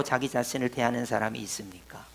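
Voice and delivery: whispered rhetorical question; voiceless, hushed aside to the congregation. Real, not acted. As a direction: drop to a hushed whisper to make it land. Preacher drops to rhetorical question.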